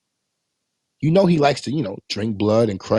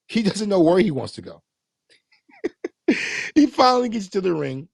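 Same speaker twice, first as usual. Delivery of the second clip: normal talking voice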